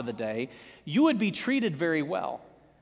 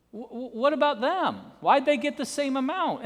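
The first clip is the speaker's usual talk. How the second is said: indignant complaint; 8 semitones higher than usual. Retold or imitated. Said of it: "we say".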